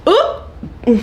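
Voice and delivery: breathy laughing hiccup; a whispered hiccup and giggle. Real, not acted. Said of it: "I have the hiccups".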